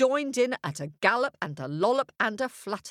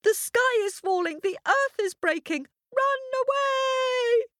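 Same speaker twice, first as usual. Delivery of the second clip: much higher, frantic hare cry of alarm; panicked shout. A narrator's reading. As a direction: squeal it out like a terrified little hare. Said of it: "What did they shout?".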